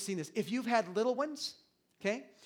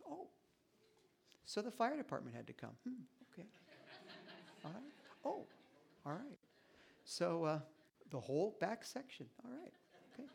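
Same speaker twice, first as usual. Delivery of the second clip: mock restrained alarm; 2 semitones higher, 9 dB quieter than usual. Shown as a demonstration.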